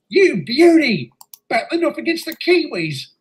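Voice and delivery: high, thrilled cheer; excited sports fan. Acted. Bruce hearing that Australia plays New Zealand, thrilled. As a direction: crow with delight over the big match. Learns who plays in the match.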